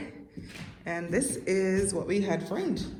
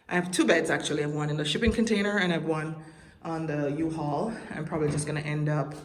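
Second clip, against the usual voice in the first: normal talking voice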